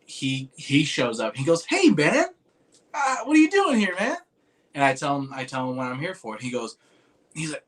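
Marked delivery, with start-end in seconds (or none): 1.7-4.2 much higher, louder friendly guy voice; loud, high impression of a surprised friend; imitating Aaron's greeting. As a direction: mimic a buddy spotting you in the crowd, pitched way up. Quoting Aaron greeting him.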